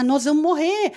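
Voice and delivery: high-pitched alarmed outcry; panicked retold cry. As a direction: disciples crying out in fear, retold. Discípulos chamam Jesus na tempestade. (grito aflito, imitado)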